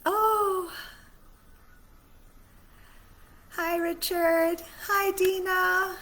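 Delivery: high, loud and drawn-out greetings; delighted surprise. Real, not acted. Spotting friends in the live chat, thrilled high greetings. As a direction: gush with joy at seeing friends online. Suddenly sees live viewers' comments.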